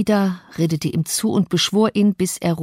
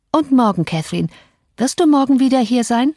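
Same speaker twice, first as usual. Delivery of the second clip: higher, louder anxious plea; clinging plea; 7 semitones higher, 4 dB louder than usual. Acted. Linden begging Cathy to come tomorrow, high and eager. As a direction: hold her back with an eager plea.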